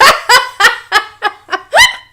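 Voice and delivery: high, loud rising laugh; real laughter at what Cooper said; big laugh. Real, not acted. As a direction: lose it laughing.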